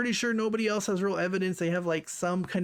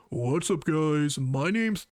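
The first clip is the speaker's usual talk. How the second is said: lower, mock streamer intro voice; put-on deep voice; 4 semitones lower, much slower than usual. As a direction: drop into a fake deep greeting. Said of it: "having a voice like this".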